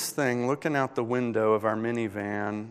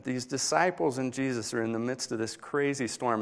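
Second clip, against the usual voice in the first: ordinary talk, nothing marked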